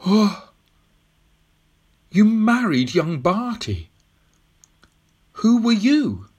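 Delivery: put-on high, halting boyish amazement; astonished. Tom learning whom the old woman married, astonished. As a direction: blurt the question in disbelief, pitched high.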